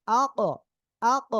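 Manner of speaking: "আ, ক" with a hard echoing release on ক; bounced qalqalah. Shown as a demonstration. Teacher showing qalqalah in "আ, ক", pushed bouncing consonant. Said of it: "ধাক্কা দিয়ে পড়ব".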